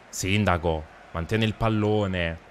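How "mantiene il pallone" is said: ordinary talk, nothing marked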